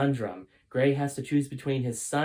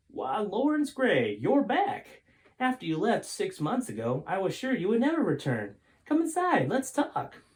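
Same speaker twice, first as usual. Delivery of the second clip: put-on high, excited old man's greeting; Folger's voice; 9 semitones higher, 4 dB louder than usual. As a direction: greet a long-lost friend, pitched up high. Old farmer Folger greets Gray.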